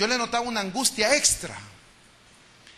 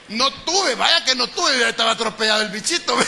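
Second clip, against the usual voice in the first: louder, amused talk through laughter; laughing while speaking; 3 semitones higher, 5 dB louder than usual. Real, not acted. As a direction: chuckle through the words.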